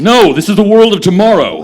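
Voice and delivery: loud, high, booming showman; grand proclamation. Acted. Doctor insisting to the scoutmaster, loud proclamation. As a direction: proclaim it to the whole park.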